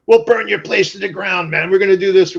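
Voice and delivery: lightly voiced menacing skinhead; threatening skinheads. Skinheads threatening the tattoo artist, mock menacing. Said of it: "They're like".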